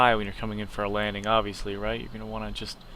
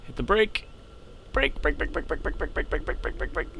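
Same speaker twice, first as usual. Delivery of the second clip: urgent repeated braking; 4 semitones higher than usual. Real, not acted. Urgent repeated call during crash landing.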